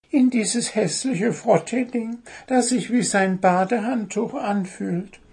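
very high bird voice; high little bird tattling; blabbing bird. Acted. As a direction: finish the sentence gleefully. Bird finishes the sentence.

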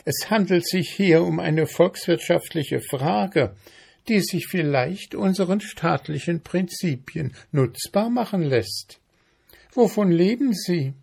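high, lecturing ant voice; officious ant. A narrator's reading. Ant lecturing mayfly on economics, officious.

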